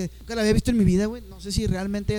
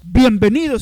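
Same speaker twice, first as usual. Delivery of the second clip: a huge, much louder stage welcome; the host greeting the crowd as the lights come up, booming; announcer welcome; 13 dB louder, slower than usual. Retold or imitated. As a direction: boom it out like a stadium MC.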